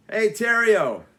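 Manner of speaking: loud bully call across the hall; goon calling. Acted. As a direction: shout it like a jeering bully.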